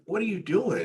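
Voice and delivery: high-pitched questioning imitation; imitated puzzled friend. Retells puzzled friend questioning him.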